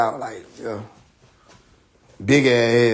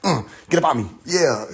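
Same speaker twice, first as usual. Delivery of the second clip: ordinary talk, nothing marked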